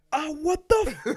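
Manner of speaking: high yelp of outrage, imitated; pissed-off dad. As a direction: act out a dad hit by surprise. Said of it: "he was so fucking pissed".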